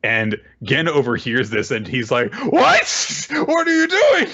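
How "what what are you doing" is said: high, startled yelp of disbelief; shocked outburst. Retold or imitated. Gen catches Senku talking in her earring, startled shriek. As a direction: jump way up in pitch like he can't believe it.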